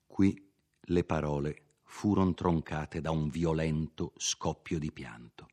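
ordinary delivery, nothing marked

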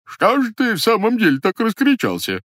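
high, put-on stranger's voice; same disguised voice. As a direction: keep up the squeaky disguise, indignant. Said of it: "произнёс он тем же голосом".

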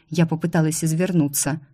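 ordinary delivery

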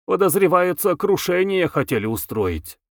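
eager-to-please informant; obsequious. A narrator's reading. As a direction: fawn a little to a superior. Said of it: "угодливо заговорил".

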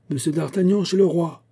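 high-pitched announcing call; usher's shout. A narrator's reading. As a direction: summon him across the antechamber. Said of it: "cria un huissier".

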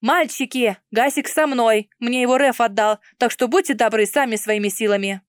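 ordinary delivery

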